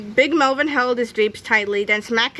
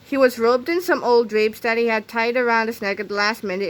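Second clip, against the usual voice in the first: usual speaking voice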